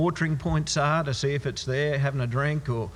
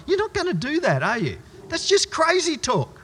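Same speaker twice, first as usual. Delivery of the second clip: ordinary talk, nothing marked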